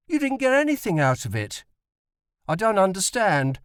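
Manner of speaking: put-on high, insistent young boy; boy's voice. A narrator's reading. Tim presses his father over supper, high and insistent.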